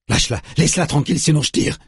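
fast, high-pitched warning; roared threat. A narrator's reading. Parissa threatening to shoot her sister's attacker, fast high roar. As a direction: fire off the threat, high and urgent. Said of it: "rugit Parissa".

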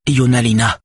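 appel plus haut et plus fort, tendu; voix tendue. A narrator's reading. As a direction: une mère angoissée l'interpelle. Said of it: "dit-elle d'une voix tendue". (voix tendue, plus haute)

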